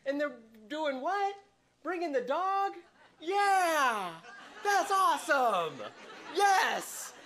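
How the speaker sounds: high, giddy retold excitement about family coming; retelling his thrill that family is visiting; excited. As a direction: squeal over the dog coming too. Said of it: "I'm like".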